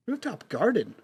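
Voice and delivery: rising, impressed double-take; surprised. Acted. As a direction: say it like it's unexpectedly fancy. Repeats the venue in surprise.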